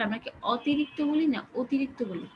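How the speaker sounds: ordinary talk, nothing marked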